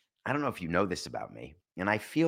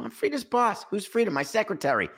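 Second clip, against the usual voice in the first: Fletch dialogue; 12 semitones higher than usual. Retold or imitated. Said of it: "retold Fletch scene".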